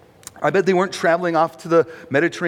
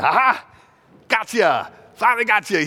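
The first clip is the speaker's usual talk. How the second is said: higher-pitched, triumphant mock "caught you"; gotcha; 6 semitones higher than usual. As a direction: pounce like you just caught someone out. Imagined God catching someone out.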